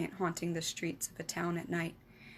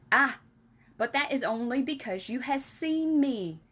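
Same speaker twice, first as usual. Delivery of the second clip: cried; 6 semitones higher, 5 dB louder than usual. Acted. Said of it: "cried the B F G".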